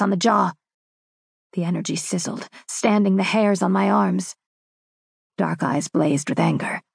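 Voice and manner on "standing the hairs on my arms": ordinary delivery, nothing marked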